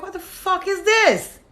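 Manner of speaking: high, drawn-out mock bewilderment; baffled disbelief. Retells bafflement at crying club customers.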